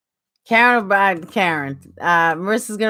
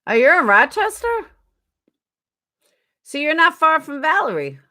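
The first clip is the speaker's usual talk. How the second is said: ordinary talk, nothing marked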